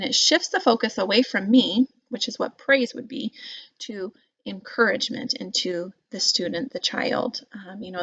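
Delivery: ordinary talk, nothing marked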